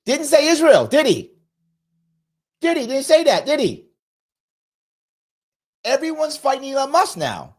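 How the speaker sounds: high, loud mocking rhetorical questions; sarcastic jab. Real, not acted. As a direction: needle them with pointed sarcasm. Sarcastic jab at Jeffries' silence.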